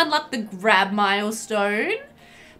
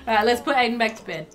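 ordinary talk, nothing marked

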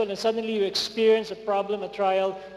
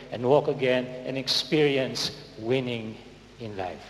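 tender, sinking close; 7 semitones lower than usual. Real, not acted. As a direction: promise it gently, slowing down. Preacher sinks into tender close.

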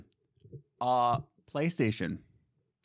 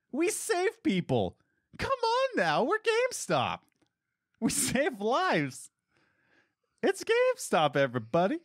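mocking corporate hype; 11 semitones higher than usual. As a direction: parody the company's boast. Mocking GameStop's claim.

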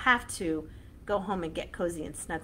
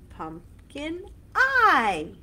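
loud slow call; 7 semitones higher, 7 dB louder, much slower than usual. Shown as a demonstration. Chant with punched endings.

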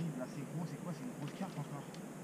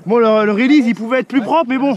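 loud; 3 semitones higher, 18 dB louder than usual. Real, not acted. Loud comment over noise.

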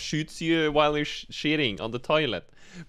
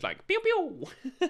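slow, high playful pew pew; gun noises; much slower than usual. Pretend. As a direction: make little gun sounds, laughing. Imitating a gun firing.

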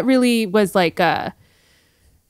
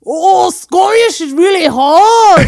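high, loud, drawn-out bad Scottish; attempted Scottish accent; 7 semitones higher, 6 dB louder, slower than usual. Shown as a demonstration. Guest tries Scottish on request, hammy Scottish accent. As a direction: struggle through a Scottish accent. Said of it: "Could you do a go Scottish".

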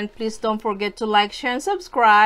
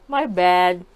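ordinary talk, nothing marked

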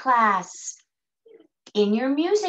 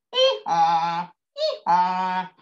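donkey bray; 5 semitones lower, 4 dB louder, slower than usual. Shown as a demonstration. Teacher asking children to make that sound, donkey bray. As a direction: bray loudly, dropping on the haw. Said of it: "Can you make that sound?".

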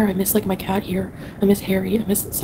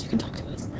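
breathy whispered echo, much softer; 11 dB quieter than usual. Retold or imitated. Streamer echoing family's words to viewers, whispering. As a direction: let the repeat fade to a whisper. Family's reassurance, echoed softly.

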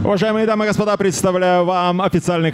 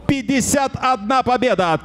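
ordinary talk, nothing marked

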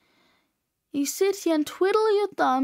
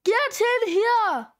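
high, drawn-out yell; loud coach-style holler; shouted order; 6 semitones higher, 3 dB louder, slower than usual. Acted. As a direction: yell a summons, stretching each word. Coach barks order at student.

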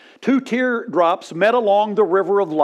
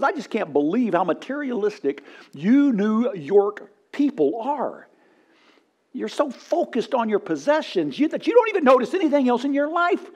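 disgusted policeman; 6 semitones higher than usual. Retold or imitated. Policeman scolds New York businessman.

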